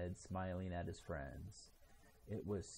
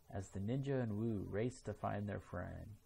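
ordinary delivery

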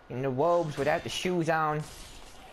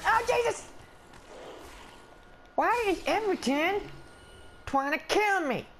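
high, alarmed wail of complaint; panicked outcry; 13 semitones higher than usual. Pretend. Player attacked by the dog he greeted, panicked complaint. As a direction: cry out high at being attacked.

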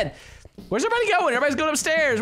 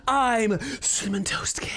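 whisper. Acted. Intro name whispered.

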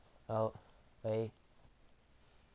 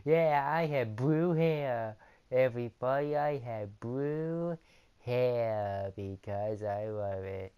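goofy Dustin voice; 7 dB louder than usual. Pretend. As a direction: boast about the blue hair, dragging it out. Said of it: "Dustin's going".

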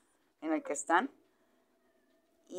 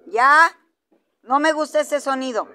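raised, higher-pitched telling-off; stern reprimand; 4 semitones higher, 7 dB louder than usual. Real, not acted. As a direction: put a firm stop to the growling. Telling dogs to stop growling.

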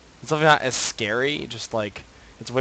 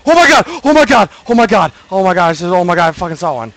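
much higher and louder, fading down; panicked screaming; 7 semitones higher, 14 dB louder than usual. Real, not acted. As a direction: shriek at the monster then calm a bit. Spots monster, screams.

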